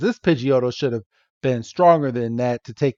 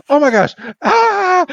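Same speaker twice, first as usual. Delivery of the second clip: high scream as they drop; very high mock scream; falling scream; 4 dB louder than usual. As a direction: shriek while falling out of the tree. Team Rocket falling from tree.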